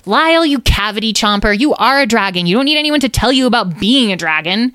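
high, quickening telling-off; exasperated scolding. Acted. Someone scolds Lyle for his silly remark, exasperated.

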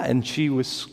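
ordinary delivery

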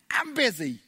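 loud, high busy excuse; hammed-up "leave me alone" shout; mock brush-off. Acting someone brushing God off.

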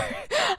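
a quick squeal of laughter; high short laugh. Real, not acted. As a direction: laugh off the silly idea. Laughs at own mock line.

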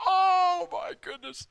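high, startled oh my goodness; imitating his own shock. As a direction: gasp it out in shock. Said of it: "I'm like".

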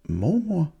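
high puzzled question; mother puzzled at her daughter's words; confused. A narrator's reading. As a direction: ask it in bewilderment. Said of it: "sagde mor forvirret".

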